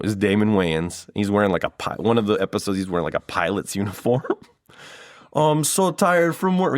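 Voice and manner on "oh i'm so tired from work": much higher, hammed-up Jamaican dad coming home worn out; terrible Jamaican accent. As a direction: groan it like a sitcom Jamaican father after a long shift. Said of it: "forgive him the terrible Jamaican accent".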